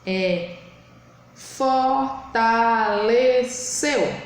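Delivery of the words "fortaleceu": "fortaleceu" very slow: for, ta, le, ceu; teacher sounding out "fortaleceu" for counting; syllable by syllable. Shown as a demonstration. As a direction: draw out every syllable of "fortaleceu". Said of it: "você tem que falar".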